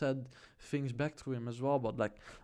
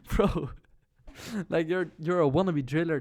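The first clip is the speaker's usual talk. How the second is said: laughing; 3 semitones higher, 5 dB louder than usual. Real, not acted. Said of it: "I just couldn't stop laughing".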